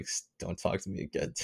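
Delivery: very low, hushed retold thought; muttered plea. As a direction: say it under your breath. Retold silent plea to her.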